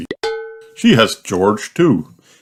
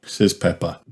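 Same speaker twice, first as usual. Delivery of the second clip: ordinary delivery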